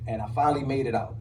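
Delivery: ordinary delivery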